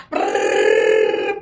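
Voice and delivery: low rolled trill sound; low lip trill brrr. Shown as a demonstration. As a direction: imitate the French rolling out. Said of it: "ça sort comme ça".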